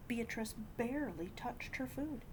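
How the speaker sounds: usual speaking voice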